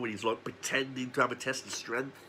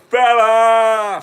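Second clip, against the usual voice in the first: very loud high bellow; sudden shout; 10 semitones higher, 17 dB louder than usual. Acted. Reviewer bursts out at the camera, very loud high bellow. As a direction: yell it out of nowhere.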